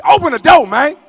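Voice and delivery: high, very loud shout; furious shout. Retold or imitated. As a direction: yell it at the door. Yelling at locked door.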